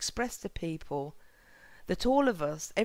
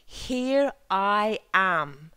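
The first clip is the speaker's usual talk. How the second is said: slow, louder answer as Moses; 5 dB louder, much slower than usual. Acted. Moses answers God from the bush, slow and louder. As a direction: draw out Moses' reply, each word held. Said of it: "and Moses says".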